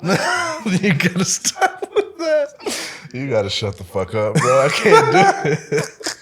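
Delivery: imitating dad while cracking up; laughing through dad quote. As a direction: try to quote dad but keep laughing. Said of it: "my dad was just like".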